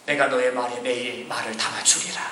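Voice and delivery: low, hushed divine voice; God's solemn promise. Acted. God replying to the protesting prophet, low and solemn. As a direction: answer softly and deeply as God.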